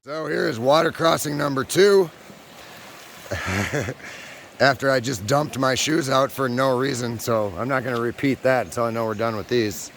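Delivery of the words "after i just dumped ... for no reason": usual speaking voice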